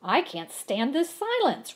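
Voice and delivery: a loud high roar; loud frustrated roar. A narrator's reading. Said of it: "roared Mister Winky".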